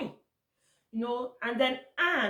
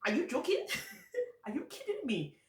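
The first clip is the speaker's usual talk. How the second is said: incredulous; 10 semitones higher, 4 dB quieter than usual. Retold or imitated. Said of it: "I'm like".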